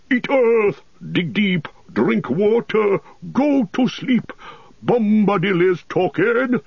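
chanted rebuke; commanding Tom Bombadil. A narrator's reading. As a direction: order the tree back to sleep.

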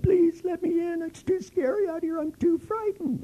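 hammed-up scared falsetto crying; mock fright. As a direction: fake being terrified to get in. Pretending to be frightened.

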